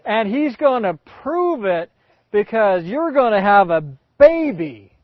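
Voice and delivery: high, loud triumphant reveal; triumphant Abraham. Acted. Abraham announcing the baby to Sarah, loud and triumphant. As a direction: land the punchline loudly. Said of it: "Abraham goes".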